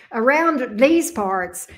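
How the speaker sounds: slow, exaggerated Southern drawl on "Around these parts". Shown as a demonstration. Streamer playing up her accent for viewers, hammed-up Southern drawl. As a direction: lean hard into the Southern accent and stretch each word. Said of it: "I sound like I'm so Southern".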